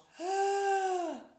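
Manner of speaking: high straining gasp. A narrator's reading. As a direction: gasp in harder. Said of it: "atmete der kleine Tiger".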